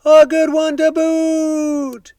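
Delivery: very high, slow, drawn-out chorus voice; crew agreeing. A narrator's reading. Pirate crew echoing their captain, high slow chorus. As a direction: echo it like a silly pirate crew. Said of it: "the others agreed".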